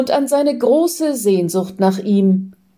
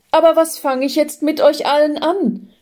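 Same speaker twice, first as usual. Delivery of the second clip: high-pitched, helpless question; overwhelmed; 8 semitones higher, faster than usual. A narrator's reading. Man surrounded by so many feelings, overwhelmed. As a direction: plead as if swamped by it all. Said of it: "völlig überfordert von so vielen Gefühlen".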